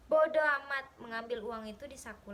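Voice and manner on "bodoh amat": very loud, curt outburst; loud, scornful husband; angry dismissal. A narrator's reading. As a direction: burst out angrily and brush her off. Husband angrily dismisses wife, grabs money.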